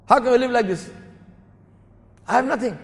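high-pitched mock scolding; indignant lecturing. Thief complaining that there is nothing to take, indignant. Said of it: "giving Hasan, Hasan Basri some bhashan".

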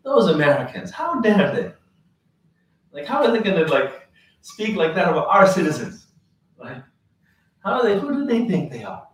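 angry sheikh shows the file, retold; mad sheikh. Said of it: "He was actually mad at America".

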